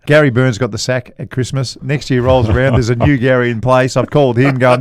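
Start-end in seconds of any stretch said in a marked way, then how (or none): none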